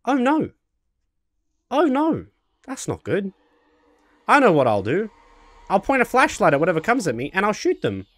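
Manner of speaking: high, chirpy unfazed delight; mock-happy socially awkward guy; cheerfully oblivious Alan. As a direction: act thrilled by every disaster. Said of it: "I am now gonna also be Alan".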